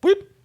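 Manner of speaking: a quick high rising "whoop" like a comm badge; mouth comm chirp. Reader chirping the comm badge signal, high and quick.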